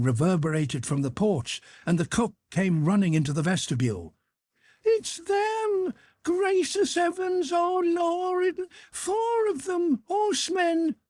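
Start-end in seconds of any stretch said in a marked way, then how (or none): 4.8-11.0 very high, frantic cry of panic; terrified cry. A narrator's reading. Terrified cook cries to the household about horsemen, very high. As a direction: shriek the alarm as she runs in. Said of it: "she cried".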